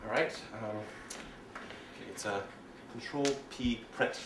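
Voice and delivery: soft, slow, half-whispered fumbling; quiet muttering at keyboard. Shown as a demonstration. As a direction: mutter to yourself while typing. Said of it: "more like this".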